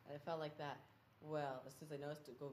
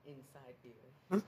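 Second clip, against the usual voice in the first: mock show intro; 5 dB louder, much slower than usual. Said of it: "slow announced show title".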